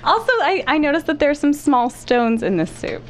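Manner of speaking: pitch-shifted masked voice; altered voice to hide identity; disguised voice. Real, not acted. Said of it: "we have her voice disguised".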